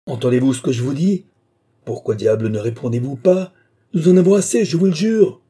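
loud demands building to high shout; exasperated anger. A narrator's reading. Officer gets no answer from worker, exasperated shouting. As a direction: lose patience out loud.

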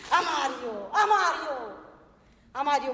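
loud call across a distance. Acted. Calling to Mario from afar, loud and insistent. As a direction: shout his name to get his attention.

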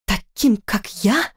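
puzzled question with a rising lift; bewildered. A narrator's reading. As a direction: echo it back in disbelief. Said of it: "несколько раз недоуменно моргнула". (недоумённо)

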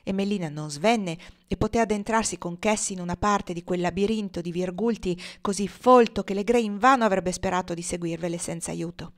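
ordinary delivery

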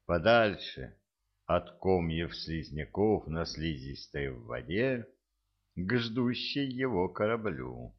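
usual speaking voice

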